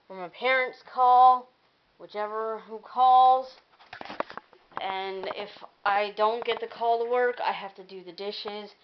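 normal talking voice

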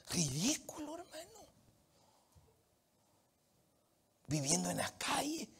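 soft near-whisper; confiding pity to listeners; hushed. Acted. Hushed aside.

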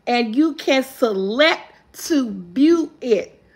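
slow clue with spaced-out phrases; slow reading. Real, not acted. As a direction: read each clue slowly, pausing between them. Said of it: "I'm trying to read it slow".

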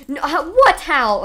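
loud high stunned outburst; can't believe it happened; shocked disbelief. Real, not acted. Sudden defeat shock.

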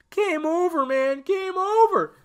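high, loud panicked marine yelling; Hudson's line. Retold or imitated. Fan quoting Hudson's famous line in a loud panicked yell. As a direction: shout it like a soldier losing it. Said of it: "He improvised that line".

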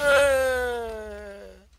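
high, loud cry of dismay; anguished shout. Real, not acted. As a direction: shout no as it all blows up. Explosion wipes out items.

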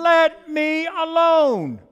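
loud, high, drawn-out protest; yelled objection. Acted. Clay objecting to the master's rolling and patting, yelling. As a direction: stretch out each word in an acted yell. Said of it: "I yelled in objection".

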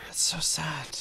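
soft sad whisper. Real, not acted. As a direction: breathe it out unvoiced. Villager fell into void.

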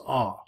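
"ah" with no h at the front; smooth breathing. Shown as a demonstration. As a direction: start "ah" straight on the vowel, adding nothing. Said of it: "This is a smooth breathing".

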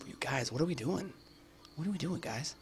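faux-innocent plea to the enemy squad; mock innocent pleading. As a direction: play dumb to lure them in. Talking to enemy players.

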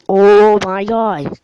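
loud, slow alarmed cry. Real, not acted. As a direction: cry out at the threat. Enemy spotted.

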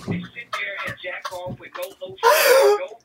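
building to a shrieking rant; mock outrage; ranting yell. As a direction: rage about it like it's a tragedy. Ranting comedic meltdown.

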